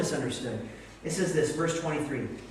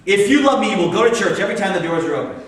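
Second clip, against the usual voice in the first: loud, raised finger-pointing rebuke; angry God; 5 semitones higher, 11 dB louder than usual. Acted. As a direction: scold from on high at full voice. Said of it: "angry, finger pointing, looking down on".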